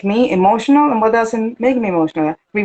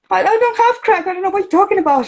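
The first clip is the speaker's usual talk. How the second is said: much higher, startled and defensive; alarmed denial; 12 semitones higher, faster than usual. Retold or imitated. Newcomer shocked when asked about crack, high alarmed denial. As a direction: blurt it out, shocked at the question. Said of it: "I said".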